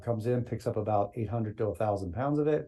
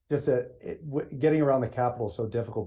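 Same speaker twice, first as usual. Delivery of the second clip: normal talking voice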